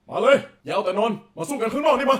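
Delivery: loud, high battle challenge; Masked Rider hero. Shown as a demonstration. Voice actor demos facing King Dark in a cave, heroic shouted challenge. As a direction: call the villain out to fight like a tokusatsu hero. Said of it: "ลองพากย์สักนิดนึงได้ไหม".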